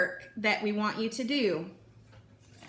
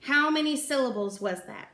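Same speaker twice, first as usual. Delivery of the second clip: ordinary talk, nothing marked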